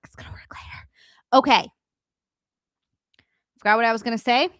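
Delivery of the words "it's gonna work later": whispered to herself. Real, not acted. Streamer reassures herself about later, whispering. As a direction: breathe it out as a private whisper.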